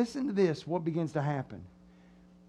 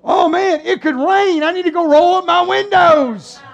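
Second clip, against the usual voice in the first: loud, high, wailing excuse; hammed-up fretting, stretch the ending; mock alarm; 7 semitones higher, 7 dB louder than usual. Acted excuse to leave church.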